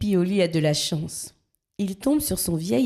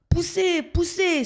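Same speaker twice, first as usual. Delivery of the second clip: high, straining breathless urging; out of breath; 12 semitones higher, slower than usual. A narrator's reading. Blackbird slipping on ice urges a push, breathless. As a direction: push with all your strength. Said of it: "s'essouffle Zigomar".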